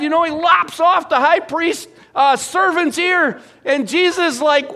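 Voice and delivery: loud, high, fast sermon build; rising preacher fervour; full-force preaching. Acted. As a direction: drive the point home to the congregation.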